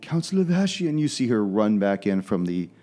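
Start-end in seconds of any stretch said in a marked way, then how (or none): 0.0-0.9 high, far-pitched woman's voice; flustered hostess. Acted. Hostess reacts to the door knock, flustered. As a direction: exclaim it high as the knock comes.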